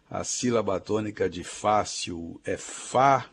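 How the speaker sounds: ordinary talk, nothing marked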